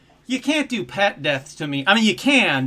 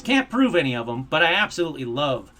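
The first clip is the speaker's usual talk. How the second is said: ordinary delivery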